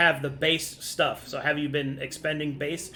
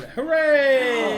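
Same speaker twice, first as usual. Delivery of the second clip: cheer; 9 semitones higher, 5 dB louder than usual. Real, not acted. Cheering the birth.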